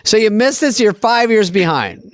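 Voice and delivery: mock exasperation. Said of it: "I'm like".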